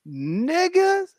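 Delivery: ordinary talk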